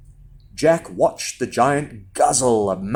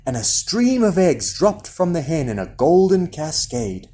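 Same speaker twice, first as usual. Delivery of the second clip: ordinary delivery, nothing marked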